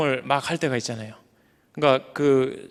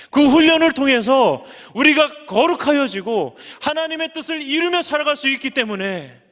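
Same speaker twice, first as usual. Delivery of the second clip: preaching; 8 semitones higher than usual. Acted. Preacher lifts voice on key point.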